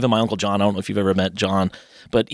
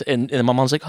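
ordinary talk, nothing marked

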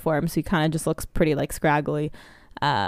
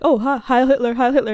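higher, louder imitation of saluting officials; a hint of over-keen inspectors; mock officious salute; 7 semitones higher, 5 dB louder than usual. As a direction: lightly parody a round of eager salutes.

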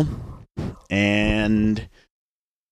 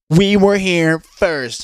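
mocking rude girl; 8 semitones higher, 3 dB louder, much slower than usual. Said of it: "they go into full-on".

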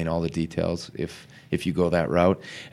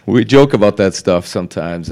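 laughing; 10 dB louder than usual. Real, not acted. Laughing at the joke.

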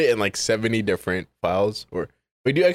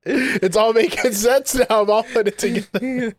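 mock realization; 10 semitones higher than usual. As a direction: act out the penny dropping. Mock realization, high, slow.

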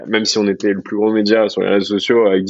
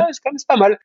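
agréablement surpris; faster than usual. Retold or imitated. Retells his own pleased thought.

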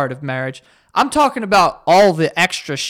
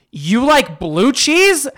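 mock disbelief; 7 semitones higher, 3 dB louder, much slower than usual. Imagined partner shocked by answer.

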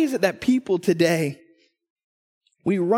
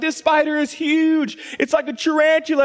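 mock alarmed wife; 8 semitones higher than usual. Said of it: "She's like".

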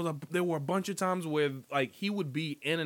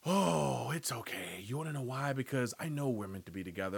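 lower, much softer, fast self-soothing; hushed creepy devotion; creepy stalker voice; 4 semitones lower, 6 dB quieter than usual. Retold or imitated. Stalker Joe reassuring himself, low and hushed.

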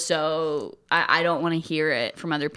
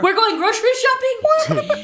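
very high, loud hammed-up squeal; mock excitement; 6 dB louder than usual. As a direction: squeal the guess playfully. Hammed-up guess at the special episode.

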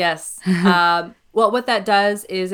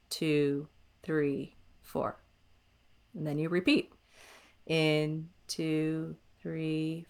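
soft, slow guided breath count; 6 dB quieter, much slower than usual. Shown as a demonstration. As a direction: count the breaths calmly and quietly. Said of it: "breathe in to the count of four".

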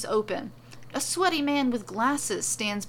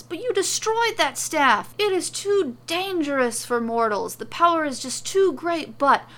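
high, loud, agitated protest; frantic yelling; 7 semitones higher, 9 dB louder than usual. A narrator's reading. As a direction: protest in a theatrical panic. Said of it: "Morrow yells".

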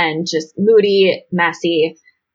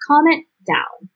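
scolding; 8 semitones higher than usual. Real, not acted. Telling Greg off.

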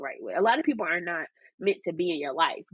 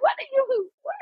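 baffled. Retold or imitated. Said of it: "I'm like".

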